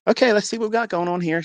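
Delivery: brisk and friendly; chirpy nurse. Retold or imitated. Nurse starting a sonogram for the couple.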